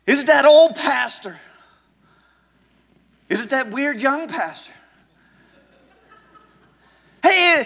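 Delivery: loud rallying preacher cry; preaching at full force. Acted. Pastor rallying the congregation to refuse, full-force preaching. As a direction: call the crowd to stand firm.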